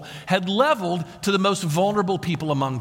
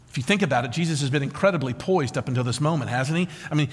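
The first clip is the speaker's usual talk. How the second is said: ordinary talk, nothing marked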